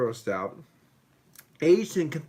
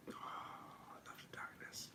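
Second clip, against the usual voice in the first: breathy, longing whisper; 26 dB quieter than usual. Real, not acted. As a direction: breathe it like a secret love. Whispered aside about a beer.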